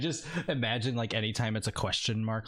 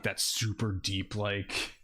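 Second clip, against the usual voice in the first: mock deep voice; 4 semitones lower, slower than usual. Shown as a demonstration. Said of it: "voice filter over somebody".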